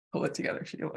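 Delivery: muttered under her breath to herself; whispered aside. Real, not acted. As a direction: whisper it to yourself. Whispered pep talk to herself.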